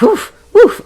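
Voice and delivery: sharp high woof-woof huffs through the nose; breath of fire huffs. Retold or imitated. Describing her breathwork to listeners, high breathy woof-woof huffs. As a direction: imitate the rocking breath of fire in puffs. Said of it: "like, breathing".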